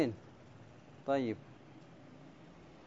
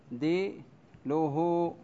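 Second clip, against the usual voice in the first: تعديله drawn out syllable by syllable; slow scansion; much slower than usual. Shown as a demonstration. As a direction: spell out تعديله slowly by its beats. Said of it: "سأقطعها بالأسود حتى يتضح". (تعديله ببطء شديد مقطعا مقطعا)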